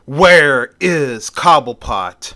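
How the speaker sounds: slow, loud villain's demand. Acted. Mister Freeze demanding Cobblepot, loud and slow. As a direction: call it out loudly, drawing the words out. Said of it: "he says aloud".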